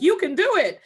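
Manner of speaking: high, loud mock pep talk; mocking cheerleader voice. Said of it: "here she is like".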